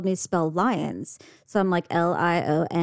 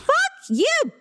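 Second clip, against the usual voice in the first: put-on high, angry kid voice; a furious kid cursing the teacher; childish outburst.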